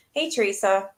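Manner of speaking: ordinary talk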